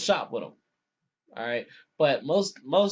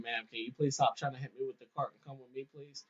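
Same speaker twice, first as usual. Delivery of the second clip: softer and a bit quick, patient; calm, polite plea; 3 semitones lower, 10 dB quieter than usual. Retold or imitated. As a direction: plead politely, keep it quiet. Guard calmly asking lady to stop.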